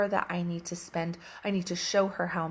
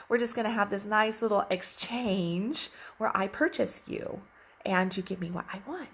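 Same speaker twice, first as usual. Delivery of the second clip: usual speaking voice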